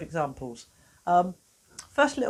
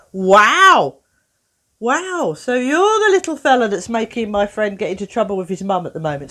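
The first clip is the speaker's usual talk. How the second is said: playful mock surprise; 2 semitones higher, 9 dB louder than usual. Talking to the boy's tapping foot.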